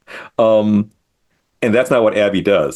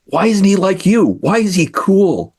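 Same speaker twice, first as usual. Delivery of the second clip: high, drawn-out mock complaint; puzzled kid; 10 semitones higher, slower than usual. Friends question him about his cool brother, high mock bewilderment. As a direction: imitate a baffled kid, pitched way up and slow. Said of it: "was kind of saying".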